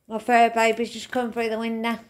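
normal talking voice

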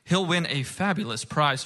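normal talking voice

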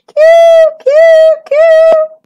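very high, loud bird coos; piercing bird calls; seagull coos. A narrator's reading. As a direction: call out like a gull over the beach.

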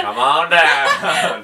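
slow drawn-out announcer call; game show host. Pretend.

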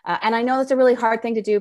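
ordinary talk

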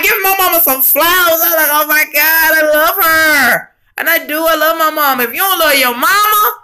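high, choked-up voice on the edge of crying; real tears while talking about her mama; tearful. Real, not acted. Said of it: "Y'all, I was in tears".

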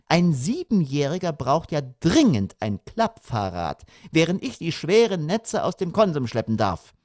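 high-pitched, pointed reproach; needling mother. A narrator's reading. Mother blaming father for spoiling the son, needling.

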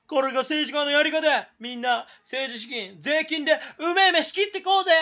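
声を張り、高く威勢よく呼びかける; 関西弁混じりに大声で煽る; 悪い政治家の演説. Acted. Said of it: "俺が政治家になって". (政治家になりきって大げさに叫ぶ)

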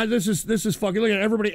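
ordinary talk

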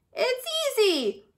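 high, slow, singing-out cry; cried out. A narrator's reading. Turtle calling from inside her shell, high cry. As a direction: call it out high and drawn out. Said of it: "cried Turtle from inside her shell".

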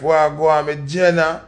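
usual speaking voice

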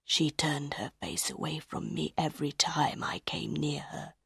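hushed, halting confession; whispered. A narrator's reading. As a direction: whisper it in wonder and old hurt. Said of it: "he whispered".

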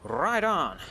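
loud high triumphant cheer; excited high exclamation. Real, not acted. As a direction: shout it in delight. Cheering in game.

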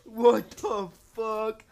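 shocked loud yelling; shouting. Real, not acted. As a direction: yell it out in shock. Startled by a fall in the shower.

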